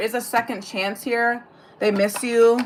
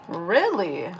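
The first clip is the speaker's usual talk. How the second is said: "Really?" dropped low and soft, unimpressed; deadpan "Really?"; 4 semitones lower, 5 dB quieter than usual. Real, not acted. Reacting to smudged lens comment, dry deadpan. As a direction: flatly mutter "Really?" under her breath. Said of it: "Really? ... Really?".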